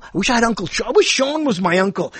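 usual speaking voice

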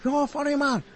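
a clumsy imitation of a Japanese listener's accent; mock Japanese accent. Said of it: "I don't even know how to do these accents".

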